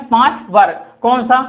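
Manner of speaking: normal talking voice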